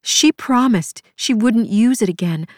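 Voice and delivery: usual speaking voice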